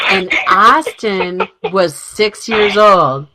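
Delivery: ordinary delivery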